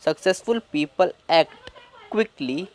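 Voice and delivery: very slow, word by word; slow reading. Shown as a demonstration. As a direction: read slowly for students. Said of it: "quick". (धीरे-धीरे पढ़ना)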